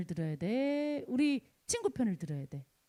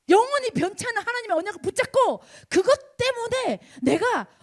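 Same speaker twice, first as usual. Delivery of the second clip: high, loud preacher's cry; fiery preaching shout; 6 semitones higher, 7 dB louder than usual. Real, not acted. Pastor preaching to students, shouting at full force. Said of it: "소리 안 지르려고 그랬는데".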